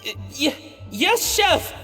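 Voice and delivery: halting, nervous obedience; cowed stammer. Acted. Scolded assistant answers the head chef, nervous stammer. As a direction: stumble on the first word, then get it out.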